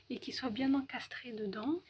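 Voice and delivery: soft voiceless whisper. Real, not acted. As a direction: whisper so nobody wakes.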